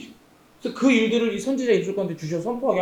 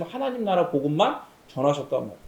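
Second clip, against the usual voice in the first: ordinary talk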